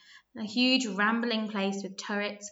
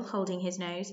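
plain reading voice

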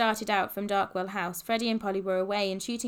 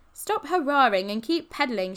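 raised, louder storytelling shout; shouting back; 4 semitones higher, 6 dB louder than usual. A narrator's reading. Freddie to Polly behind on the bikes, shouting back. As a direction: call back over your shoulder. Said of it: "shouted back Freddie".

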